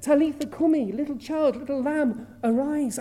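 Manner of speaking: put-on Jesus raising the child. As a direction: call the child up from death. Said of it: "He said".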